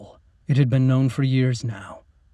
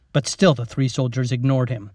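plain reading voice

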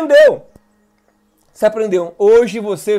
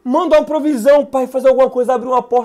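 higher pitched, urgent begging prayer; desperate supplication; 6 semitones higher than usual. Shown as a demonstration. Pastor demonstrates supplication prayer, pitched high and pleading. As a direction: plead with God, one request after another. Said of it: "Súplica".

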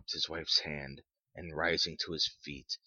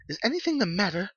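high, loud, very fast frightened question; breathless alarm; 8 dB louder, much faster than usual. A narrator's reading. Startled mother asks the visitor for news, breathless and alarmed. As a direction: rush it out in rising panic. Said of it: "she asked breathlessly".

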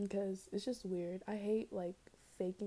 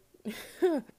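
high, loud burst of laughter; exasperated laugh; 7 semitones higher, 8 dB louder than usual. Real, not acted. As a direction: laugh it off in disbelief. Laughing after losing episode.

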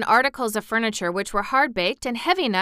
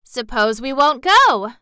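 louder defiant challenge; provoked; 4 semitones higher, 8 dB louder than usual. Acted. Dorothy challenges Mister Bun, provoked and defiant. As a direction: dare him to make you. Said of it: "who was now much provoked".

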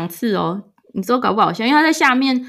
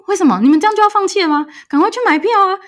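higher, fast, pushing panic; frantic urging; 10 semitones higher, 3 dB louder, faster than usual. Retold or imitated. Retelling her frantic plea to the parents to buy tickets, higher and fast. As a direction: hurry them along, no time to waste. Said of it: "我很紧张".

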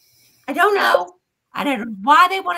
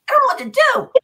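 high, louder retold panic; panicked quote; 9 semitones higher, 4 dB louder than usual. Retelling her fear of the strangers, high panicked blurt. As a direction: blurt it out like you're frozen in fright.

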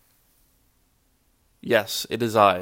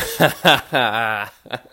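hearty laugh; 8 dB louder than usual. Real, not acted. Laughs at his own joke.

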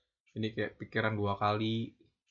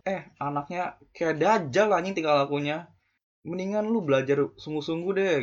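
angry outburst; 5 semitones higher, 4 dB louder than usual. Real, not acted. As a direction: vent the frustration, higher and louder. Angry rant at reckless kids. (marah meledak-ledak)

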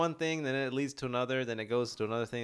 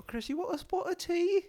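high, slow put-on English accent; bad English accent; slower than usual. Teasing friends, he tries a high, slow, bad English accent. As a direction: ham up a terrible posh English accent. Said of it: "That was horrible".

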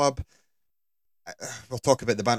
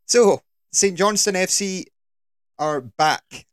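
announcer voice; 7 semitones higher, 5 dB louder, slower than usual. Acted. Podcast intro announcement.

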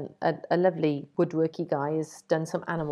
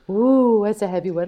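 delighted ooh at a heavy frame; pleased ooh; 7 dB louder than usual. Retold or imitated. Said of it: "I always go".

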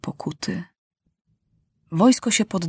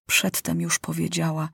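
ordinary delivery, nothing marked

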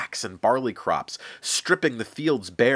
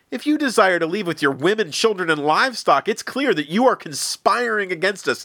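much higher, louder scornful sneer; mock him with loud high scorn; 12 semitones higher, 5 dB louder than usual. Pharaoh sneering at Moses's demand, loud high scorn. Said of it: "The Pharaoh sneered back".

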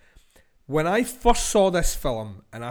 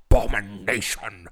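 Bill Nighy impression; 7 semitones lower than usual. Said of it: "he has a particular way in that film of saying abomination".

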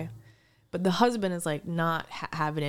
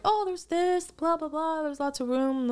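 mimicked realtor tour patter, pitched high; high-pitched imitation of a house showing; 7 semitones higher than usual. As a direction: rattle off the house's features, high and offhand.

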